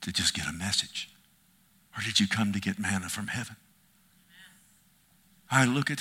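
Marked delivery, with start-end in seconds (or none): 0.0-3.6 soft questioning. Acted. Quiet questioning of the hearers.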